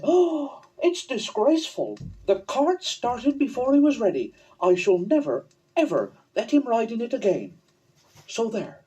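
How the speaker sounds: high, huffy mother's voice; indignant mother. Acted. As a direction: sniff with offended outrage.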